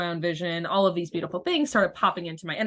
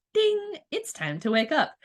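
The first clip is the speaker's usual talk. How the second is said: playful wake-up call; 9 semitones higher, slower than usual. Pretend. Universe calling her awake.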